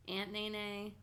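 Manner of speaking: usual speaking voice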